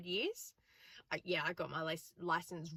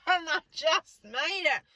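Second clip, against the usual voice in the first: breathless; 9 semitones higher, 7 dB louder, slower than usual. Real, not acted. Out of breath after the test.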